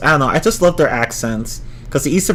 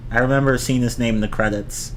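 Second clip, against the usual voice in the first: usual speaking voice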